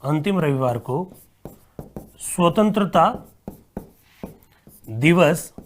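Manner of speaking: dictating word by word with long pauses. Acted. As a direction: read the question out for students to write. Dictates a question slowly.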